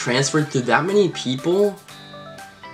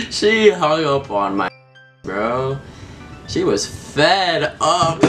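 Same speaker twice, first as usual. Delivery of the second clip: slow words through laughter; can barely talk for laughing; laughing while talking; 4 semitones higher, much slower than usual. Real, not acted. Laughing after hang-up.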